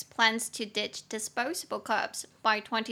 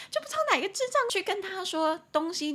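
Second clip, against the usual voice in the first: high, indignant rant; exasperated, pitched-up complaint; openly cursing; 9 semitones higher than usual. Real, not acted. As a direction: scold an absent fool in comic outrage. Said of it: "我应该可以直接骂吧".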